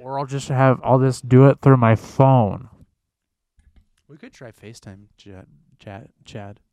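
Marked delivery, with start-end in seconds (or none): 0.0-2.7 loud, slow, hammed-up dread; loud complaint. Real, not acted. Host griping about his old laptop, loud. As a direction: moan it out loud, half-joking.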